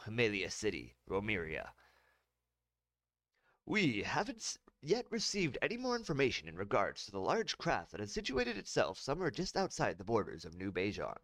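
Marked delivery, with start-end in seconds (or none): 3.6-11.2 news anchor read. Acted. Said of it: "News anchor read from teleprompter".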